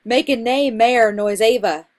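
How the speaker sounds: "nae mair" said as nay mare, "ava" as ay-va; "nae mair" with long ay and air vowels; dialect pronunciation. Shown as a demonstration.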